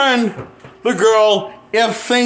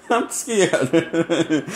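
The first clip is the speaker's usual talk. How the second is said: nervous laugh; 5 semitones lower, 5 dB quieter than usual. Real, not acted. Laughing nervously about movie.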